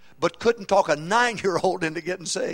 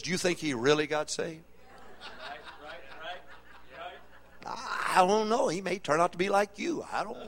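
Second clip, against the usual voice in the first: mock doubtful partner; 8 dB quieter than usual. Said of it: "your partner says".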